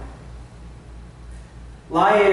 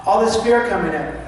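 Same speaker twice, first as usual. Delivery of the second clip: usual speaking voice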